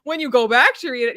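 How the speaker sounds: ordinary delivery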